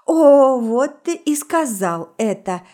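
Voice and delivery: loud burst on the opening "О"; gloating, slow-paced outcry; triumphant exclamation. A narrator's reading. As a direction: pounce on it in triumph, then draw it out. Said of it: "торжествующе воскликнула я".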